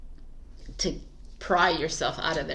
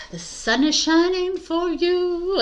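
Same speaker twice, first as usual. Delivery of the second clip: bright greeting; 10 semitones higher, 4 dB louder than usual. Real, not acted.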